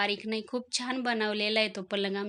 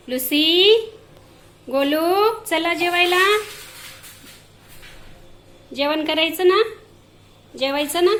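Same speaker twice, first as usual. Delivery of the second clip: much higher and louder sing-song calls; calling the cats; 7 semitones higher, 10 dB louder than usual. Real, not acted. Woman calls Lucy and Golu to eat, high and loud. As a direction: call out eagerly for dinner.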